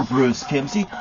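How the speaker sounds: usual speaking voice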